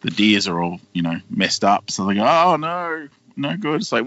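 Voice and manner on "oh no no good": high, drawn-out wail of "oh no"; mock dismay. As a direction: imitate cartoon characters in trouble. Retold dismay after the crash.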